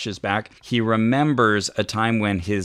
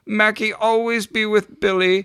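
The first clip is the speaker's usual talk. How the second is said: dying dog Mackie; 12 semitones higher than usual. Acted. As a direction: stretch each word out high and tender like a dying dog. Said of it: "This is how Mackie talks".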